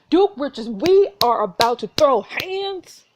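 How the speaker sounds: loud, high, drawn-out warning; playfully heated challenge; fired-up threat. Real, not acted. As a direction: call out a fight, slow and loud. Player threatens to fight the duke.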